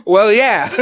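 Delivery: loud, high burst, breaking into laughter; laughing exclamation. Retold or imitated. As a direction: blurt it out as laughter takes over. Retelling own reaction, bursting into laughter.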